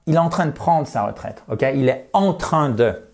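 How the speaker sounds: usual speaking voice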